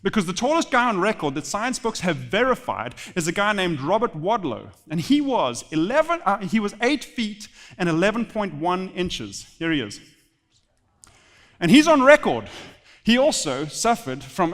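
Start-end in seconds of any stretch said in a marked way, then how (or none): none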